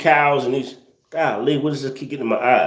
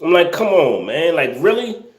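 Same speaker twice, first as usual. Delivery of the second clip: ordinary delivery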